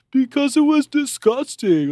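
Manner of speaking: hammed-up Bam defending himself; mocking Bam. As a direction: whine it like a spoiled kid. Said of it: "And he's like".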